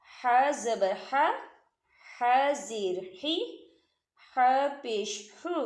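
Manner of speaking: slow drill of the letter Ha with each vowel; slow tajweed drill. Shown as a demonstration. Teacher showing students how Ha is said, slowly. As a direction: draw out हा, ही, हु from the middle throat. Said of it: "हा कैसे अदा होता है?".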